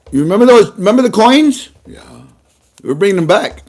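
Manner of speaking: a hint of the official answering; brisk put-on governor reply; lightly voiced governor. Governor answering audience question.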